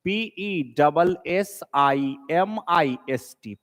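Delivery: slow, letter by letter; spelling out letters. Shown as a demonstration. Teacher spells the word for students, slowly. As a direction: dictate each letter slowly, as for a class. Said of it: "pessimist का spelling गलत है".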